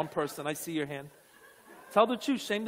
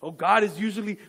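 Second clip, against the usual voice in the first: ordinary talk, nothing marked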